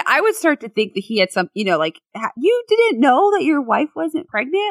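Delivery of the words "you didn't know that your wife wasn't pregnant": high-pitched, disbelieving challenge; imitating a baffled skeptic, voice pitched up; mock incredulous questioning. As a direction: question him as if you can't believe it. Voicing people questioning the husband.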